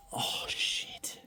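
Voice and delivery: breathless whispered curse, no voice at all; hushed whisper. Real, not acted. Prospector whispering over a find so the neighbour won't hear. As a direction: whisper it so the nearby camper can't hear. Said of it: "I'm being really quiet".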